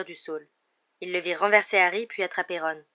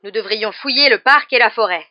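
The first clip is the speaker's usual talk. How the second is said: loud, higher-pitched growled order; angry growl; 4 semitones higher, 13 dB louder than usual. A narrator's reading. Executioner urging a search, growling. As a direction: growl out the demand forcefully. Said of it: "grogna le bourreau".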